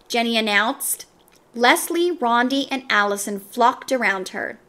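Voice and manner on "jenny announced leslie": ordinary delivery, nothing marked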